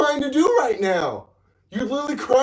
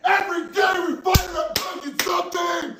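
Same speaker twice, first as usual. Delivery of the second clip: higher, hoarse frustrated shouting; screaming; 4 semitones higher than usual. Real, not acted. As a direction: yell it out, worn down and fed up. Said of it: "why are you screaming at me?".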